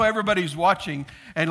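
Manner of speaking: ordinary talk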